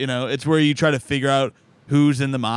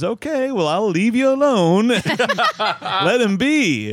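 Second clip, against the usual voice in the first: high, laughing "leave you alone"; squeaky pitch-up retelling, cracking up; high stripper voice; 9 semitones higher, 4 dB louder, slower than usual. Retold or imitated. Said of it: "she goes".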